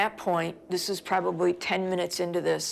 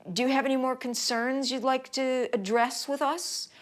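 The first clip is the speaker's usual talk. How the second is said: high, condescending mock politeness; smug singsong challenge, pitch raised; cocky; 5 semitones higher than usual. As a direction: imitate an official baiting a complainer. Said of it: "didn't like the tone".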